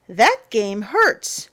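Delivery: ordinary delivery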